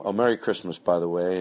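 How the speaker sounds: ordinary delivery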